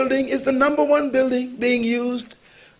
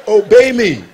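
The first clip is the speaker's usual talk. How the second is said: laughing command; 3 semitones lower, 6 dB louder than usual. Retold or imitated. Pastor commands woman, laughing.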